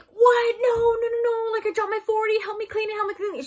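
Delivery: high, loud frantic "no, no, no"; panicked pleading. Retold or imitated. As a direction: beg a friend in a panic to help clean. Panicking as the mom approaches.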